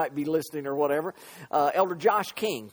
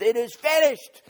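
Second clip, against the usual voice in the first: raised, high cry imitating Jesus; preacher's loud cry; 6 semitones higher, 5 dB louder than usual. Preacher demonstrates the Bible's loud voice, crying out. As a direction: cry out the words with force. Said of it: "the loud voice that the Bible says".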